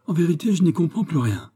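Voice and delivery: high-pitched, hammed-up mirthful exclamation; as if overcome with amusement, pitched well up; amused, acted. A narrator's reading. King baffled by the letter, highly amused. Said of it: "se prenant à rire aux éclats".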